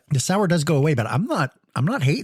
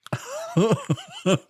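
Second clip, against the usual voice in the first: high-pitched burst of laughter; real laugh. Real, not acted. Host cracking up over inhaled pledge fumes, high laugh.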